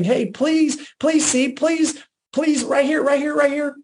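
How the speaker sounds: high-pitched, urgent begging; pleading. Acted. Spouse chasing partner for validation, pleading. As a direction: plead as if chasing after someone. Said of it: "we're chasing them around saying".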